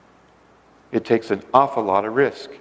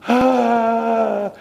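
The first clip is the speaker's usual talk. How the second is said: high relieved ah sound; 12 semitones higher, 3 dB louder than usual. Retold or imitated. Couple's reaction to ministry.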